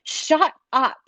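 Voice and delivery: high-pitched mock yell; retold scream. Retelling his mother's outburst on the phone, a mock scream. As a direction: imitate a scream without full volume.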